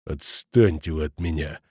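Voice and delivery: low, slow growled brush-off; grumbling through teeth. A narrator's reading. Schmidt telling the headmaster to leave him alone, grumbling. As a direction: mutter it through gritted teeth. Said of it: "проворчал тот сквозь зубы".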